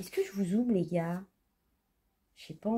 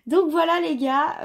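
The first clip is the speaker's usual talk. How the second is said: excited; 8 semitones higher, 7 dB louder than usual. Real, not acted. Loud hype to viewers.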